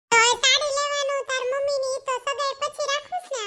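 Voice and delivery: high friend voice, chatty; friend's voice. Acted. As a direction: pitch up into the friend again. Langu voice again.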